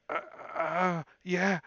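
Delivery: a cold frightened prisoner stumbling over his words; shivering prisoner. Acted. Prisoner answers.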